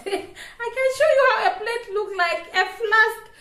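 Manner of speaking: high-pitched, laughing delivery. Real, not acted. Vlogger offering to show viewers a plate, laughing. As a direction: laugh through the words, pitch climbing high.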